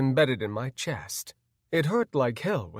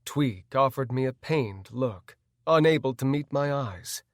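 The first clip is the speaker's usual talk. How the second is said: ordinary delivery, nothing marked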